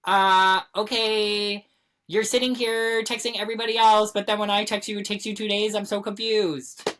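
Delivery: loud, high-pitched incredulous griping; exasperated mock confrontation. Fed-up friend confronting someone who texts everybody else, exasperated. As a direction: call out the texter, indignant and baffled. Acted reaction to friend on phone.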